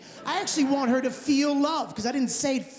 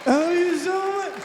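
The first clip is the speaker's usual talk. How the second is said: mock emotional dad; 8 semitones higher than usual. Imagined tearful dad scene.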